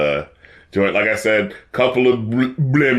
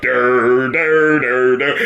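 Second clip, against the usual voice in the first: vocal imitation of a beat starting; beatboxed beat; 2 semitones lower, much slower than usual. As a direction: hum the beat like a synth. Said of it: "the beat kinda start up".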